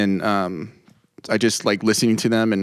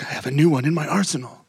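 higher-pitched mock glee; gleefully showing off a new trick; retold excitement; 6 semitones higher than usual. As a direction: relive the excitement, pitch lifted. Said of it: "I was so excited".

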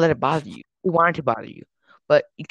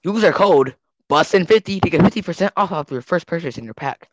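ordinary delivery

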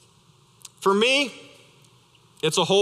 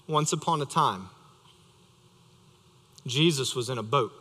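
bedtime story; 6 semitones lower, 6 dB quieter than usual. Acted. Father starts bedtime story.

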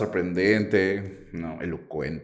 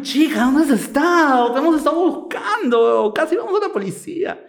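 worried friends. Retold or imitated. Friends bursting in after searching for her, high mock worry. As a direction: squeal it like a panicked friend. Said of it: "llegan mis amigos".

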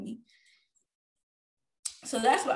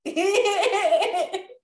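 bright rising burst of laughter; real delighted laugh; high laugh; 8 semitones higher, 4 dB louder than usual. Real, not acted. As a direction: laugh out loud, climbing up. Laughing at own story.

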